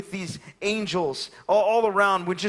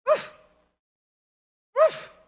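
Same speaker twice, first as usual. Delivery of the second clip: imitated bark; 4 dB louder than usual. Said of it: "I can hear".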